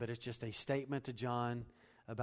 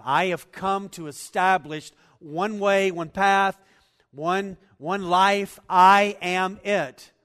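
loud, higher, slow preaching; preaching at full force; 7 semitones higher, 16 dB louder, slower than usual. Acted. Preacher proclaiming Jesus' claim to congregation, loud and slow. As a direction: hammer each phrase home.

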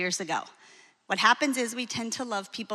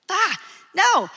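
exasperated no; 10 semitones higher, 8 dB louder than usual. Retold or imitated. Mock reaction to reading nothing.

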